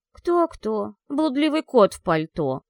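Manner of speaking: loud indignant retort; spat out. Acted. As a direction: spit the words out. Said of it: "выплюнула Софи".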